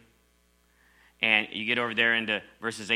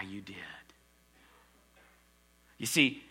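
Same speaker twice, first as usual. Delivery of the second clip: ordinary talk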